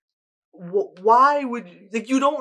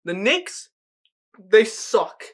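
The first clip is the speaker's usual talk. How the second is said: bad New York accent; lightly put-on New York accent; Michael Rappaport impression; 4 semitones higher, much slower than usual. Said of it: "I'll put my Michael Rappaport on".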